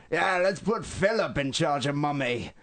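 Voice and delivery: mock Australian bloke, over the top; exaggerated Australian accent, high and sing-song; bad Australian accent. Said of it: "worst accents humanly possible".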